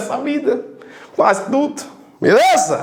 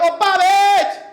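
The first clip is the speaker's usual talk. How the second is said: higher call stretching "re" in "parede"; calling out the word; 6 semitones higher, slower than usual. Shown as a demonstration. Teacher showing the class the tonic syllable of parede, calling out. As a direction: holler it with the stressed syllable held. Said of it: "Agora grite ela".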